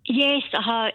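ordinary talk

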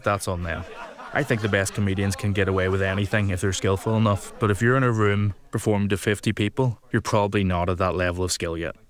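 ordinary delivery

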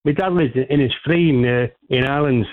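caller hamming up a bad Cockney accent; a fake Cockney caller, poorly done. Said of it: "your Cockney accent is as bad".

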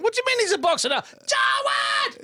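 shrill mock outrage at the son's boxing news; mother shouting. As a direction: yell up the stairs like a shocked mother. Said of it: "And she's going".

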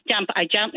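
"jump" said as "jamp", with an A vowel; Inverness dialect jamp. Shown as a demonstration. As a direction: say "jump" the Inverness way, rhyming with "lamp". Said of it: "in Inverness they say".

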